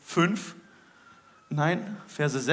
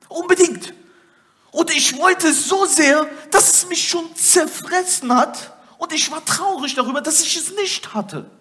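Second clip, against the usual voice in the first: high, loud, driving sermon voice; fervent preaching; 10 semitones higher, 6 dB louder than usual. Acted. Preacher rousing his congregation, fervent loud preaching. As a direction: preach it out to the whole hall, full force.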